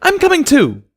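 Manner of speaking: high, loud cry; cried out. A narrator's reading. Pina crying out to leave with Dinky. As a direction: call out to join them. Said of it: "cried Pina".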